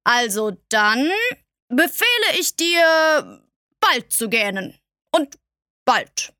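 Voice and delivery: slow, with BEFEHLE lifted high; a king fumbling for a face-saving order; muttering, annoyed king. A narrator's reading. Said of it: "Er murmelte ein bisschen und schien verärgert".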